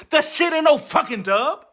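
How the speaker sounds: normal talking voice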